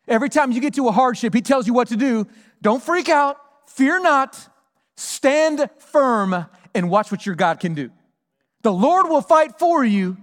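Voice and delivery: rally the room with it; full-force preaching. Acted. Pastor rallying his congregation in hardship, fervent.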